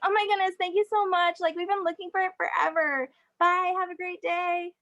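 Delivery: put-on high, gushing Valley Girl thanks; overly sweet ditzy Valley Girl. Valley Girl act continues.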